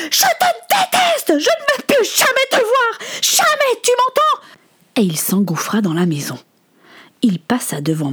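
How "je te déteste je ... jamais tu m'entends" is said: extremely high, hateful scream. Acted. Son turns and screams at his father, furious. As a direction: shriek it with everything, voice at its peak. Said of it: "il s'arrêta et lui cria".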